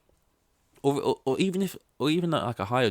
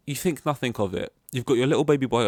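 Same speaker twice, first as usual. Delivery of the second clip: normal talking voice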